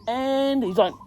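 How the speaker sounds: ordinary delivery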